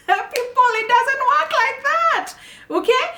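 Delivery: very high, loud incredulous outburst; squeaky-high exasperation; exasperated protest. Real, not acted. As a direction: exclaim it in laughing disbelief, way up high. Exasperated reply to imitated lazy client.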